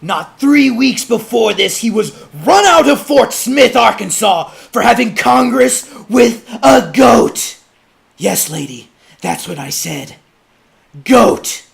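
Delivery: high, loud orator's denunciation; judge's public denunciation. Acted. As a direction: thunder the accusation to the crowd.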